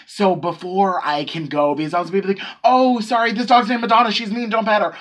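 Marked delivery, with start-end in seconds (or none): 2.6-4.9 high, loud apologetic call-out; urgent warning. Pretend. Worker warning a guy off the dog, urgent. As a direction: call out a polite warning, pitched up.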